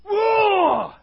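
loud, high, drawn-out roar; bellowed wordless roar; giant's roar. Acted.

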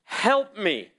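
breathless, pleading call out loud; desperate plea. Retold or imitated. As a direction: cry out to God at last.

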